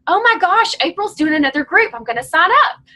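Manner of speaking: high, loud opening gasp of delight, then eager chatter; gushing groupie. Acted. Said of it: "They'd be like".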